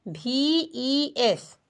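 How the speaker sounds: V E S drawn out very slowly; teacher spells the ending slowly; slow spelling. Shown as a demonstration. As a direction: answer her own question by spelling slowly. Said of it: "क्या जुट जाएगा?".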